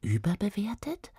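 slow, thoughtful whisper; musing whisper. Acted. Queen musing to herself.

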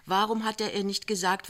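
usual speaking voice

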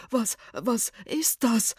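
slow, frightened stammer; spooked, drawn-out question; creeped out. A narrator's reading. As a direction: stutter the question in dread. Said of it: "gruselte sich das Gespenst".

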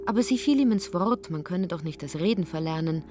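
ordinary talk, nothing marked

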